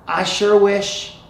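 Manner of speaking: put-on high, loud, drawn-out Texas boy; Texas accent. Boy caught off guard starts wishing, slow Texas drawl. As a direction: stretch it out in a wistful Texas drawl. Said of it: "in a Texas accent".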